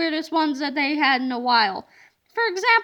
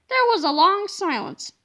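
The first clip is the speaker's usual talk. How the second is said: plain reading voice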